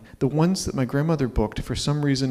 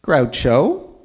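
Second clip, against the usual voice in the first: grandmother's retort; 6 semitones higher, 5 dB louder, slower than usual. Retold or imitated. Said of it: "And she said".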